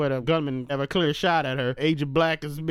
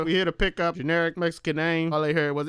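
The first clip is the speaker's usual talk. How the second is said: ordinary talk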